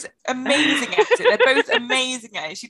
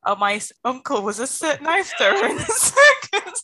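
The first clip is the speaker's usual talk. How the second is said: laughing through a quote; 4 semitones higher, 4 dB louder than usual. Retold or imitated. Friend quoting the liar's line, cracking up mid-sentence. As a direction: quote broken by giggles. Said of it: "then says".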